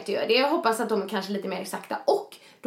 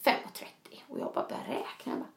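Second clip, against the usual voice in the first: hushed, breathless whisper while doing sums; whispered mid-race worry; whispered counting; 11 dB quieter than usual. Retold or imitated. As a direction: whisper it to yourself, alarmed. Panicked inner counting mid-race.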